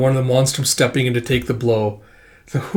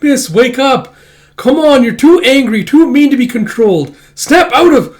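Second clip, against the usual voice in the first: high, loud pleading voice; pleading little fox; 12 semitones higher, 8 dB louder than usual. Acted. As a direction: beg her to snap out of it. Kippa pleading with sister.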